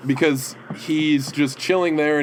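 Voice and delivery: usual speaking voice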